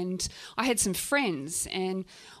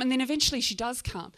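plain reading voice

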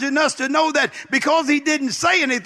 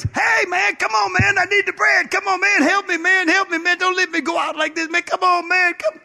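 desperate begging neighbor; 7 semitones higher than usual. Acted. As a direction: hammer the door and beg for bread.

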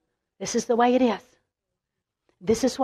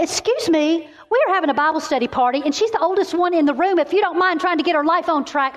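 raised-pitch, quick-fire telling-off; mock indignation; 6 semitones higher than usual. Woman scolding the enemy at her Bible study party, mock indignation. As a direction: tell off an intruder, hammed-up outrage. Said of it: "I was so mad at the enemy".

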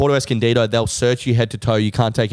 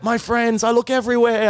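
charming thief voice; 11 semitones higher than usual. Retold or imitated. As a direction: coo it like a smooth street dealer faking innocence. Teller voicing the thief again.

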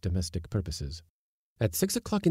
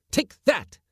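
louder angry smack; 5 dB louder than usual. A narrator's reading. Smacking the kitten.

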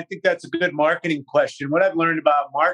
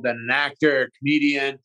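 ordinary delivery

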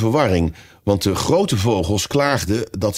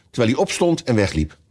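ordinary delivery, nothing marked

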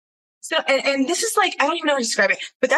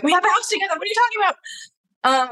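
desperate plea; 9 semitones higher than usual. Retold or imitated. Retelling her desperate plea.

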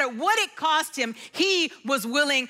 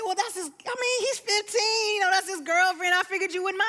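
high-pitched sheepish imitation; defensive excuse; 7 semitones higher than usual. Brother explains the girlfriend to his sister, defensive.

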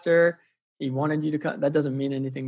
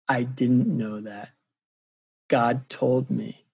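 very slow, deliberate retelling; hushed-moment gravity, firmly voiced; slow, solemn revelation; 3 semitones lower, slower than usual. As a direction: let each word land with weight. Retold solemn revelation to stunned man.

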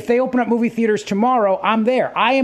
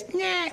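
thin, high squeal, much softer than talk; high-pitched squeal; 11 semitones higher, 10 dB quieter than usual. Retold or imitated. Dad imitating his daughter's scream heard on a work call, small high squeal. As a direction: imitate a child's piercing scream in miniature. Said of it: "this really high pitch".

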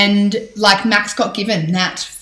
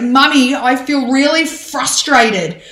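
higher, slow, lightly voiced little boy; imitating toddler son; 6 semitones higher, 5 dB louder, slower than usual. Son tells mummy he's frustrated, lightly imitated child voice. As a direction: play her small son naming his feeling. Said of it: "He's like".

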